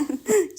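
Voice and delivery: loud high burst of laughter. Real, not acted. Laugh after camera joke.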